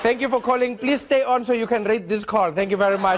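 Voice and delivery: loud scripted hotline voice; mock call-centre sign-off. Operator ending the call, loud scripted sign-off. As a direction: recite the canned closing line.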